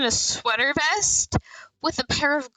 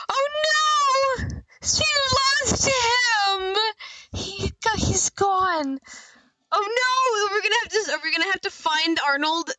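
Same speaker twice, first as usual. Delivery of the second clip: distressed; 9 semitones higher than usual. Acted. Toy lost, baby crying.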